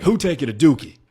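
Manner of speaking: high, loud mock demand; imitated demanding shout. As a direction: yell it like someone pounding on the door. Man banging on toilet door.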